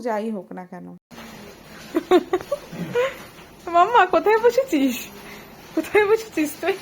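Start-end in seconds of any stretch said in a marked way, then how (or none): 1.8-3.2 bright high laugh. Real, not acted. Mother laughing at baby. 3.8-6.8 calling out. Real, not acted. Calling to toddler across room.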